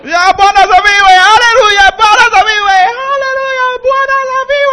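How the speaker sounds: high loud calls turning into a sung chant; rising call and response. Retold or imitated. Preacher retelling shouting praise back and forth with the crowd, high and ecstatic. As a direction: build the chant, lifting into song. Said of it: "我就给他连喊十次".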